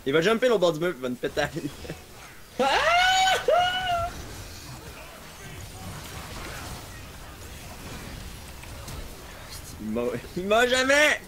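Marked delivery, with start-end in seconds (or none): 2.2-9.8 high shrieking laugh. Real, not acted. Laughing fit at unkillable enemy. 9.8-11.2 exasperated yell. Real, not acted. Frustrated at unkillable enemy.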